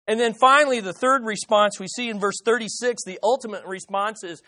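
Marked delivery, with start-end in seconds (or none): none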